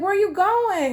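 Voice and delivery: high-pitched retold pleading question; alarmed protest. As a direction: ask in high-voiced protest. Retold protest at his leaving.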